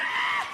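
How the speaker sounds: loud burst of imitated laughter; sudden acted guffaw; cop cracking up. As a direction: mimic someone dying laughing. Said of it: "The cop starts dying".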